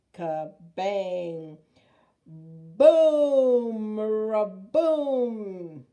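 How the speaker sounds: booming crash, very loud; loud thunder boom. A narrator's reading. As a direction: roar it like thunder. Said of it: "The thunder growled".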